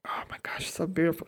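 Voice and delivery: breathless whisper of awe; awed whisper. Retold or imitated. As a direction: whisper it as if starstruck. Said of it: "I'm like".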